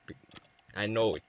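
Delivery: ordinary talk, nothing marked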